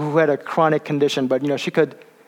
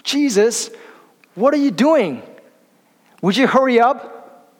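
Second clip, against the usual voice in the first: higher, impatient mock shout at Jesus; imagined shout; 7 semitones higher than usual. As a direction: call out to him like an angry, hurrying father. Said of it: "he might have shouted at Jesus".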